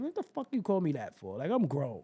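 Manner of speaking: ordinary delivery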